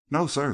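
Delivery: a valet's firm denial; emphatic. A narrator's reading. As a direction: stress the no, sir, firmly.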